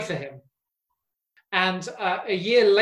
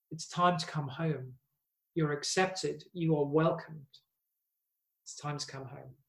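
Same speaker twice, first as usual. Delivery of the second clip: tender invitation; 4 semitones lower, 9 dB quieter than usual. Retold or imitated. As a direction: speak it softly as God calling home. God is saying to the people.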